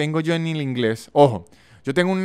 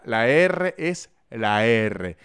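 ordinary talk, nothing marked

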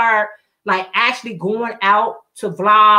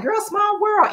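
put-on high-pitched girlfriend-to-girlfriend exclamation; retold excitement; excited reply; 9 semitones higher than usual. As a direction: act out a delighted agreement, pitched up. Retells own excited reply to friend.

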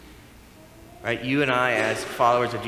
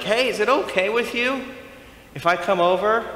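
high, loud mock-polite request; polite asking; 10 semitones higher, 6 dB louder than usual. Imagined Jesus asking Zacchaeus if he may visit, mock-polite. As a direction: ask permission with the pitch lifted high. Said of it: "He doesn't go like".

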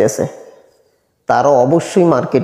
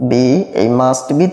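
slow teaching; much slower than usual. Shown as a demonstration. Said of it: "teacher models the pattern".

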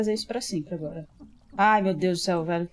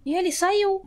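sudden high, loud yelp; startled cry; 11 semitones higher, 5 dB louder than usual. Real, not acted. Gamer surprised by something escaping, yelping. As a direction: jolt up in shock mid-sentence. Monster jumps out in game.

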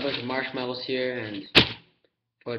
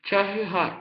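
talking with a full mouth; cheeks stuffed full; 8 semitones higher, 3 dB louder than usual. Real, not acted. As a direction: say it through cheeks full of marshmallows. Mouth crammed with marshmallows.

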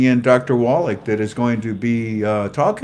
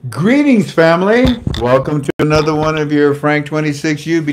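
loud, raised-pitch host welcome; show-opening announcement; 4 semitones higher, 7 dB louder than usual. Real, not acted. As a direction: announce the show to the whole audience. Opens the recorded show.

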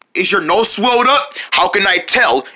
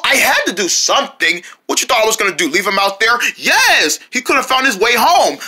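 ordinary talk, nothing marked

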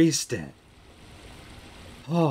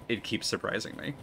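normal talking voice

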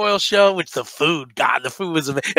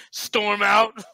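normal talking voice

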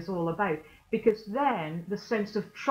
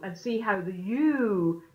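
ordinary talk, nothing marked

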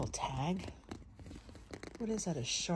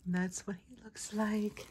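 whispering; 5 semitones higher, slower than usual. Real, not acted. Showing the plush.

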